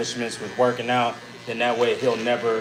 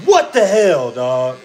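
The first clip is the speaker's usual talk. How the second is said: retold outrage; 8 dB louder, slower than usual. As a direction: act out appalled disbelief. Retold reaction to the ruined card.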